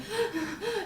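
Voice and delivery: sharp high gasp, copying her panicked breathing; a retold panic gasp; imitated gasping breath. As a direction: suck in air with a high gasp. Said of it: "she was breathing like that".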